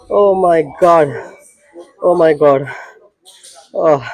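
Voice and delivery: loud, breathless after the lift; out of breath. Real, not acted. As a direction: gasp it out, exhausted. Exhausted right after the set.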